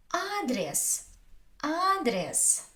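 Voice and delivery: "адрес" with high, long first a, falling after; stress on first syllable. Shown as a demonstration. Teacher giving an example, "адрес" high on the first syllable. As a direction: hit and stretch the a of "адрес". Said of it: "Я is not stressed".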